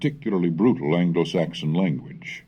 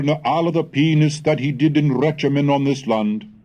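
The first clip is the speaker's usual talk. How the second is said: put-on deliberate recitation of the old chronicle; Middle English reading; 3 semitones higher, 3 dB louder than usual. Shown as a demonstration. Lecturer reading the Peterborough Chronicle to students, deliberate recitation. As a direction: read it out as period text, steady and clear.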